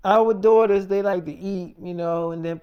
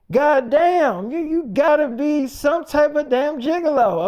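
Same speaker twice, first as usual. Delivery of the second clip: higher, louder mock exasperation; imitating the wife's loud disbelief; retold accusation; 7 semitones higher, 4 dB louder than usual. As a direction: throw the accusation at him. Wife accusing him, retold.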